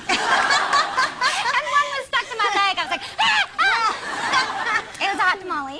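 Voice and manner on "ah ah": high loud laughing shriek; mock-startled laughing squeal. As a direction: yelp as if stung. Startled cry at candy.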